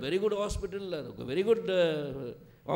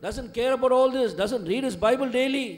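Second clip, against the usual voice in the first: louder, higher preaching; preacher scolding wayward youth, louder; rising sermon fervour; 6 semitones higher, 8 dB louder than usual. Acted. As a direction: drive the point home to the parents. Preaching at full force.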